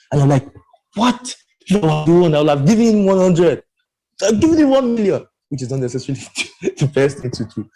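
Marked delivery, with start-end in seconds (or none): 0.9-5.3 high-pitched mock outrage, retold; incredulous outburst. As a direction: blurt it out in exaggerated disbelief. Mock outrage at brother's calm reply.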